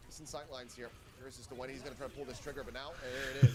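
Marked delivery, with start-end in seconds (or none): 3.0-3.6 excited call. Real, not acted. Caster calls the shot.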